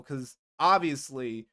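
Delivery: ordinary delivery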